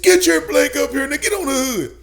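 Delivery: high, loud barked order; retold angry police shout; imitated screaming cop. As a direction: yell it like an officer ordering a suspect up. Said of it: "he's screaming at me".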